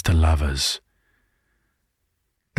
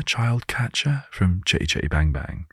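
ordinary talk

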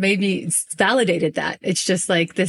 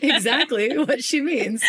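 slow, higher, broken up by laughter; laughing; 8 semitones higher, slower than usual. Real, not acted. Guest jokes about her own muddled demonstration, laughing. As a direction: laugh your way through the words. Both laughing at failed articulation.

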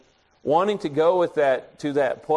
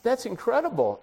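mildly amazed, pitched high; 8 semitones higher than usual. Retold or imitated. Preacher learns salesman attended his university, mildly amazed. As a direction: retell the astonishment with a high lift.

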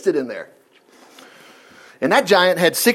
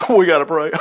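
laughing mockery.

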